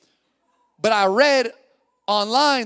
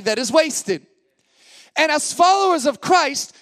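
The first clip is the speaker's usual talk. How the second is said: high, loud, emphatic preaching; impassioned preaching; 6 semitones higher, 6 dB louder than usual. Real, not acted. Pastor telling followers of Christ they have authority, impassioned preaching. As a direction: proclaim it forcefully to the congregation.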